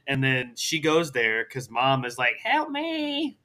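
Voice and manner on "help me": high, softer drawn-out wail; put-on damsel's plea, pitched way up; mock plea for help. Mom on the recording begs rescue, mock plea. Mom pleads in acted recap.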